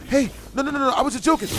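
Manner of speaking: high-pitched, hurried pleading imitation; mock begging. Girl at the bar begging him to touch her, mock pleading. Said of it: "Starts begging me to touch her".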